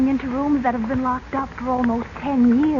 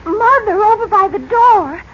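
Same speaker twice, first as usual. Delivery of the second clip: startled call; 6 semitones higher, 8 dB louder than usual. Acted. Daughter spots statue in dark room.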